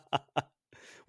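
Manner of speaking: loud, high, slowed by laughter; laughing outburst. Real, not acted. Guesser catches the host's accent slip, laughing loudly. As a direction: crow it through real laughter.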